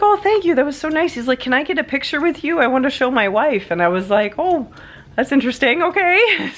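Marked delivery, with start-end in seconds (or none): 0.0-3.7 much higher, eager thankful voice; grateful gentleman. Retold or imitated. 4.4-6.5 high, puzzled and amused; bemused. Retold or imitated. Her puzzled reply to the request.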